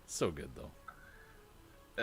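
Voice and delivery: slow, breathy whisper of delight; savoring. Real, not acted. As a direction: linger on the taste, hushed. Savoring the food.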